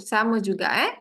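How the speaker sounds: usual speaking voice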